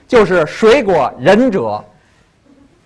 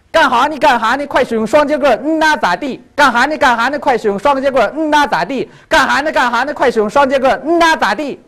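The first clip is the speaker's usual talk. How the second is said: brisk, higher-pitched Dongbei dialect chant; Northeastern Mandarin; 5 semitones higher than usual. Shown as a demonstration. Showing off a pop song in Northeastern dialect, Dongbei accent. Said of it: "一个是东北话".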